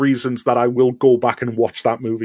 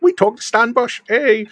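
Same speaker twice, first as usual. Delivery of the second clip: higher, giddy cheer among friends; excited cheer; 10 semitones higher than usual. Retold or imitated. Friends celebrating meeting [name], giddy and high. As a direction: crow about it like starstruck fans.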